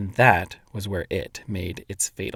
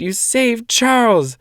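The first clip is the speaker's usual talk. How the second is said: high, slow elated cry; excited; 12 semitones higher, 6 dB louder, slower than usual. A narrator's reading. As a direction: cheer her on. Calvin continues shouting.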